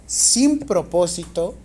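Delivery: slow, loud teacher's dictation. Real, not acted. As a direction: dictate in spaced-out chunks, projecting firmly. Teacher dictating exam question to students.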